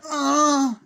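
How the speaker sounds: loud high shrieking ah; hammed-up horror-movie scream; mock scream. Imitating cameo scream.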